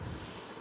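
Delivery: स as a pure hiss, "sss"; S sound alone. Shown as a demonstration. Teacher answering what S sounds like, hissed स. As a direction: hold the स with no vowel. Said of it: "एस का sound क्या है".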